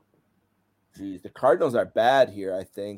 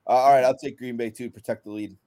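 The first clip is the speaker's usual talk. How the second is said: normal talking voice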